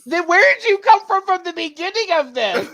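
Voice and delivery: very high, loud protest; mock outrage. Real, not acted. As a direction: squeal in laughing disbelief. Mock-protesting at co-host's late approval.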